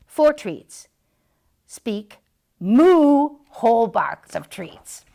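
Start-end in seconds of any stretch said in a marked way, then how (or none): 2.6-3.4 long, loud lowing moo; big moo. Shown as a demonstration. Mister Mutt showing the dog how to moo. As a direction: moo like a cow.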